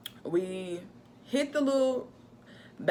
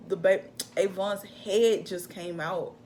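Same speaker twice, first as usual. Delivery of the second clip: ordinary delivery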